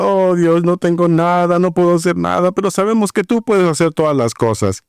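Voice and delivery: high, droning mock plea; imitated desperate prayer. Desperate congregation praying to God, imitated high pleading drone. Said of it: "simplemente estaban llorando y orando".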